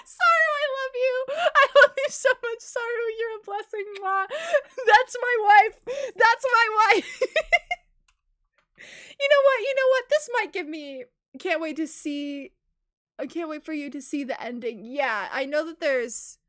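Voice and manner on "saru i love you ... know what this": high, loud, adoring squeal with laughter; gushing. Real, not acted. Streamer gushing over the character Saru, giddy and laughing.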